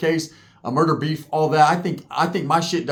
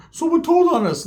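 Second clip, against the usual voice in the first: put-on whiny kid voice; 12 semitones higher than usual. Crying friend answers.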